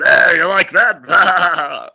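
slow, drawn-out mock villain taunt. [name] waving the cobra at Liz, slow mock heel taunt. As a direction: drag out the taunt like a wrestling heel.

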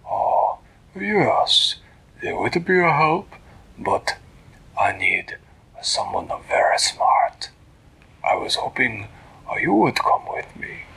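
husky, halting put-on voice; stately storybook cat king; cat ruler requesting help. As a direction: ask with royal hesitation. Voices Flibbertigibbet asking for help.

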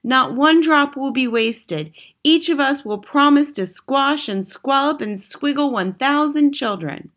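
high, fawning witch voice; eager witches. A narrator's reading. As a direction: promise it eagerly to your leader. Witches promising their leader.

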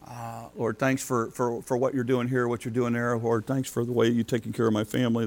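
soft, quiet everyday prayer to the Lord; casual prayer. Shown as a demonstration. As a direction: talk to God like a friend, quietly.

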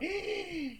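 high, sharp mock gasp; retold gasp. Test taker sees how fast he finished, acted gasp. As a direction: act out a sudden intake of breath. Reaction to finishing exam so early.

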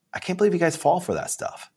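high, soft incredulous scoff; mock amazement at gullible people; lightly voiced disbelief. Retold scoff at believers.

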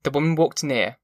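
plain reading voice

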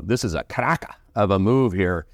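a hint of a British trader's voice; borrowed British slang. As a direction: drop into a mild British accent for the idiom. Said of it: "uh, as they'd say".

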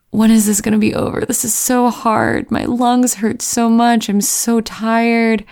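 exhausted complaint. Retold or imitated. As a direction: retell a worn-out runner's plea for it to end. Retold exhausted thoughts mid-run.

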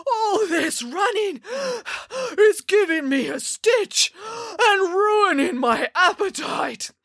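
high, slow complaint broken by gasps; out of breath. A narrator's reading. Giant complaining mid-chase, winded whine. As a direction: puff and whine through a stitch.

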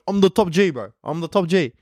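mock boyish boast, lifted in pitch; cocky little brother. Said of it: "he was just like".

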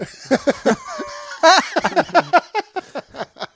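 high drawn-out laughter; real laughter; long laugh. Real, not acted. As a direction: laugh hard.

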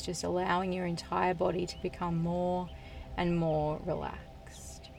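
soothing trance induction, unhurried and hushed; guided hypnosis. Acted. Leads a hypnosis session.

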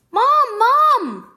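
high, loud call for Mom; yelling while running in. Acted. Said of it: "Micah yelled as he ran into the kitchen".

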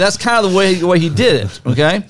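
ordinary talk, nothing marked

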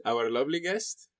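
normal talking voice

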